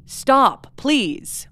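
mild reenacted pleading; retold pleading. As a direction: quote someone begging, understated. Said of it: "another pleading from Johnny".

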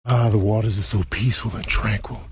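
ordinary talk

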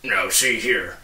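a touch of an angry watchdog; growled angrily. Acted. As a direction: watchdog objecting to the policeman. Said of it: "growled Tock angrily".

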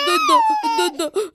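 very high giggly "دودو" with a rising end; baby babble. Acted. Baby Simba babbles to his mother, high and giggly. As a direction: coo like a laughing baby.

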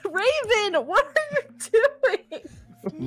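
slow, high, laughing exasperation; laughing disbelief. Real, not acted.

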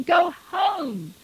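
high, loud volley of orders; strained, urgent shout. A narrator's reading. Husband sending his wife for help, urgently shouting. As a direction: fire off desperate commands. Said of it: "Retief's voice was cracking".

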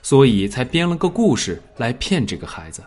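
ordinary delivery

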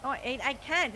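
ordinary delivery

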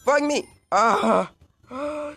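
high, loud startled cry; startled shout. Real, not acted. Gamer caught by rising lava, shouting in shock. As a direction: yell as the lava jumps up. Lava rises suddenly in game.

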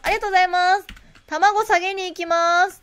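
bright shop-counter thanks; cheerful cashier thank-you; clerk thanks. Acted.

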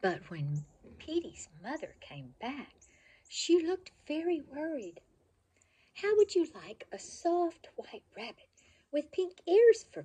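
put-on bright, lively storybook reading for kids; reading to children. A narrator's reading. Reading a favorite childhood book to children, lively storybook voice. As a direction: read it like a bedtime picture book, full of expression.

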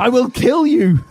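high, loud, slow threat; sudden shout. Acted. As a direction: shout it out of nowhere. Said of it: "I shouted suddenly".

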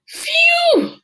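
high, loud cry of disgust; disgusted shout. Acted. Bates reeling from the skunk stink, shouting loud. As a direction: yelp it out at the stink.

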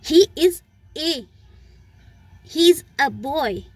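put-on he is a boy spoken very slowly, a reduced to uh; slow demo. Shown as a demonstration. Teacher slowly models the weak form in class. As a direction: spell it out word by word. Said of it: "मैं उसको weaker form में ले रही हूँ".

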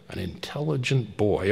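ordinary delivery

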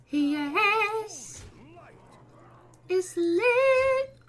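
rising into a high, loud cheer; excited shouting. Real, not acted. Gamer celebrating a winning play, excited shouting. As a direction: celebrate the win at full volume. Excited win in card game.

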